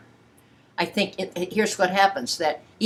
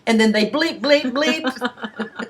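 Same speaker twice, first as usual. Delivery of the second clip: laughing, bleeps go higher; 6 semitones higher, slower than usual. Pretend. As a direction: giggle out the bleeps. Laughing mock censored talk.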